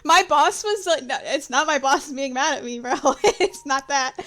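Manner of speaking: loud, high protest through laughter; laughing. Real, not acted. Player telling friends the boss isn't mad, laughing. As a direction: explain yourself while cracking up. Player cracking up while explaining.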